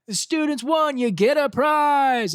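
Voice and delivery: high, loud celebratory announcement; mock cheer, drawn out at the end; camp announcer. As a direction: imitate a counselor hyping up the kids. Mimics camp announcing the winners.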